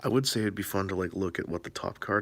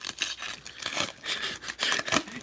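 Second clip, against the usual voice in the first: wheezy laugh with little voice. Real, not acted. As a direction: laugh at your own mistake. Laughs at own misreading.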